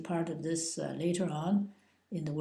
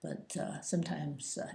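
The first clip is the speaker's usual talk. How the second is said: ordinary talk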